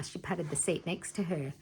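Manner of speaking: ordinary delivery, nothing marked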